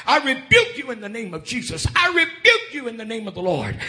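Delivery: high-pitched, forceful retold rebuke; urgent rebuke. Woman rebuking the dogs chasing her, urgent and high. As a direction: cry it out against the attackers.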